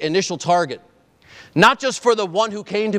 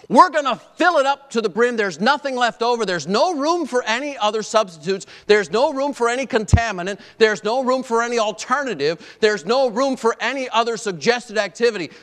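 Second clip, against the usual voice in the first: higher, driving repeated "there's no room"; building preaching; 6 semitones higher than usual. Acted. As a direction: preach it with rising fervour, stacking each line.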